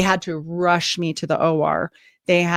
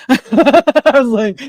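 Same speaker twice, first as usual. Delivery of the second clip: laughing; 6 semitones higher, 7 dB louder, much slower than usual. Real, not acted. Laughing while retelling story.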